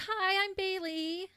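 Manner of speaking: chipper, high, drawn-out mock greeting; put-on sing-song cheer; Bailey. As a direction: imitate a perky girl, stretched out and high. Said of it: "all chipper".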